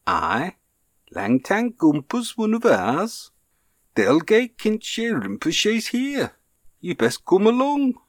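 high, slow, broad Lancashire nun; Lancashire accent. Acted. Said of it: "in a broad Lancashire accent".

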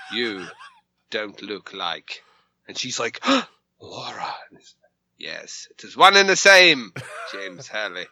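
lightly put-on accent for Madam Deadtooth; a hint of a theatrical put-on accent, dramatic reveal; mild put-on accent with a big reveal. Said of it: "I do not have this accent".

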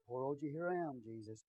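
hammed-up pity for poor Jesus; mock pitiful. Altar-goers pitying Jesus, soft mock-pitiful sigh. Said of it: "And they'll go stand in the altar".

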